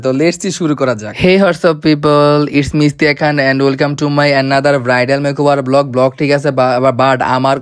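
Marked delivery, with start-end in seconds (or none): 1.2-5.8 slow, deliberately calm intro; careful slow vlog opening to please her teacher; slow and smooth. Shown as a demonstration. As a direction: take the intro slowly and smoothly, as the teacher asked. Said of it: "আস্তে আস্তে কথা বললে blog বানালে".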